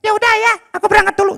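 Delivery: put-on high boy voice calling out, confused; child calling father. Boy Abdi calling his father, high and puzzled. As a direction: shout for dad like a lost little boy.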